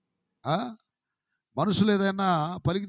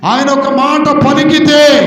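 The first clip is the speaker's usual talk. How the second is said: much louder, higher, slow and drawn out; climactic shout; 7 semitones higher, 12 dB louder, slower than usual. Acted. Pastor proclaiming God's word, slow loud shout. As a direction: let it ring to the back wall.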